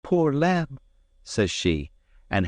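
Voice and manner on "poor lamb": high, tender pity. A narrator's reading. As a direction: coo it with pity. Maid's voice in story.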